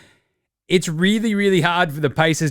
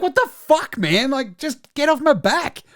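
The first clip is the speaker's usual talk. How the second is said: high-pitched mock exasperation; Al Horford; 10 semitones higher than usual. Imitated player telling presenters to back off, exasperated. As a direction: protest high, as if badgered.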